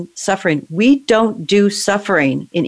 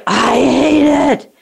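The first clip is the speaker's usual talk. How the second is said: slow, tearful words, higher in pitch; crying; 4 semitones higher, much slower than usual. Real, not acted. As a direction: let the sob slow every word down. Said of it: "deeper in your body".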